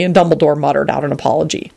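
usual speaking voice

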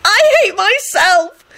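very high, broken tearful wail; sobbing. A narrator's reading. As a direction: wail in bursts as if bawling. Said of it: "kept sobbing".

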